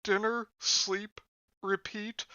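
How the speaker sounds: high, even robot-like reading; robotic, mechanical delivery. Acted. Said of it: "you sound like a robot".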